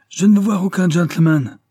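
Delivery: a father loudly refusing visitors; cried out. A narrator's reading. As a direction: shout it out in protest. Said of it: "s'écria Hutchins".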